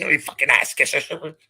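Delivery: hammed-up jeer, lightly put on; mocking Chase's insult. Mocking the troll who DMs his followers, sneering imitation. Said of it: "D Ming everybody that follows me".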